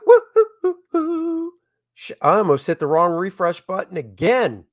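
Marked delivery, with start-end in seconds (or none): 0.1-1.5 high whooping cheer; gleeful falsetto woo hoo; excited whoop. Real, not acted. Cheering the backers.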